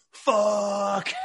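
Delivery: hammed-up cry of frustration; mock anguished yell. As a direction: yell it like a gutted, injured player. Retold injured player's anguished curse.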